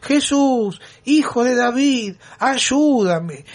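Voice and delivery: high, pleading mock shout at a passing healer; desperate cry for help. Blind beggar shouting to Jesus, high-pitched mock desperate cry. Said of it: "empezó a los gritos desaforados".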